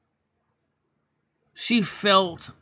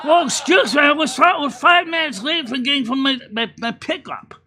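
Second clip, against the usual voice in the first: high, loud, indignant outburst with a laugh; a put-upon driver snapping at the clerk, half laughing; mock trucker rant; 7 semitones higher, 5 dB louder than usual. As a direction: bark back like a fed-up trucker. Trucker ranting back, acted.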